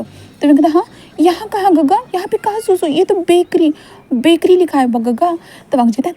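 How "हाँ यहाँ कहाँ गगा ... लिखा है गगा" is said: put-on high, questioning little-boy voice; a small boy confused at the bakery; puzzled child. Wing asks Gaga why a bakery, high puzzled child voice.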